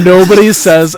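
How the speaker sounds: laughing. Real, not acted.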